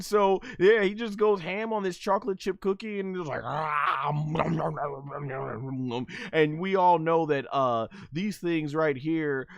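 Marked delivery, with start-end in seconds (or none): 3.4-6.1 mock chomping, nom after nom; cartoon munching noises. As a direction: imitate wolfing down a cookie. Imitates gobbling a cookie.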